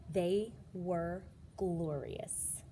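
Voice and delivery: plain reading voice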